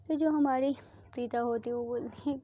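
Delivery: ordinary delivery, nothing marked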